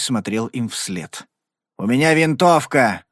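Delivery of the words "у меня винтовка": dry warning. Acted. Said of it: "сухо предупредил он".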